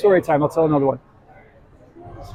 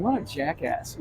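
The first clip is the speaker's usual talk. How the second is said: much softer, under the breath; muttered private thought; 11 dB quieter than usual. Retold or imitated. Passenger silently judging the magician, muttered. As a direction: mutter it to yourself. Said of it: "I'm sitting here thinking".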